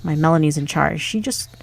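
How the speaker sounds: loud, chatty innkeeper greeting a youngster; hearty tavern owner. Acted. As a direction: boom it out like a proud tavern keeper. Voicing the tavern owner's dialogue.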